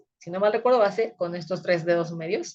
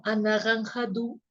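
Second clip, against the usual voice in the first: anaranjado with a French throaty R; mock French pronunciation of Spanish; French accent; 2 semitones higher, slower than usual. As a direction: imitate a French speaker struggling with anaranjado. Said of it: "Y entonces decían como".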